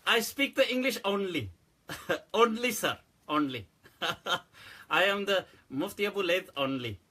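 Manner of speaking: laughing broken English; mock broken English. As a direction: play the man who only speaks English.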